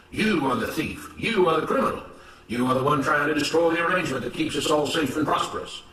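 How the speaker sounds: firm, emphatic denunciation; accusing, righteous overseer. Acted. Mister Smith blaming Samuel before the other workers, accusing. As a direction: lay the blame with self-righteous conviction.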